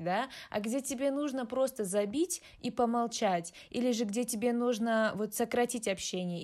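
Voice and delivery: usual speaking voice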